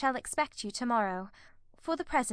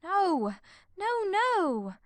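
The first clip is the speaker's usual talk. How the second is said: wildly; 13 semitones higher, much slower than usual. A narrator's reading. Marianne denying her sister, wild cry. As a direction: fling the no's out. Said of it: "cried Marianne wildly".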